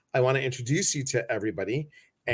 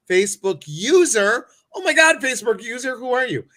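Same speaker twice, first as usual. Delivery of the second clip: higher, louder, fast playful surprise; Oh my God, Facebook user, in a teasing shriek; mock surprise; 8 semitones higher, 6 dB louder than usual. Real, not acted. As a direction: tease the nameless viewer with a high, loud gasp. Mock surprise at anonymous viewer.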